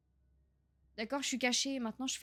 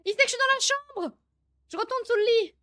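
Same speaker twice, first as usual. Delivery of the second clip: much higher, loud frightened yell; panicked shout; 12 semitones higher, 10 dB louder than usual. Real, not acted. Player realizing the monster knows where he hides, panicked yell. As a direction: scream it as the monster closes in. Monster finds him in game.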